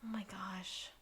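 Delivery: hushed whisper. Real, not acted. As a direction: breathe it out softly. Hushed reaction.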